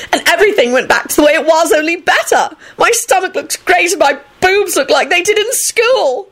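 very high, gushing delight; ecstatic. A narrator's reading. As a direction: boast in a happy squeal. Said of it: "she beamed ecstatically".

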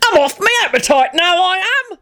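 high-pitched, put-on outcry; shouted complaint. A narrator's reading. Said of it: "Silas Scunthorpe shouted".

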